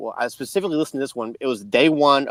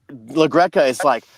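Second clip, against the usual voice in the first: usual speaking voice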